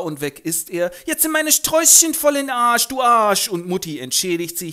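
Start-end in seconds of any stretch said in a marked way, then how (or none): 1.1-3.5 sehr hoch und laut keifend; wütende Göre. Acted. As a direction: schimpft ihm laut hinterher. Eliza schimpft Freddy nach. (laut keifende Göre)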